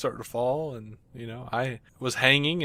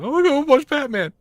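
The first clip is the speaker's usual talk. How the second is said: loud, high-pitched mock child voice; whiny kid; 14 semitones higher, 8 dB louder than usual. Kid to exasperated parent at the pool, mock whine. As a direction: plead like a little kid, hammed-up.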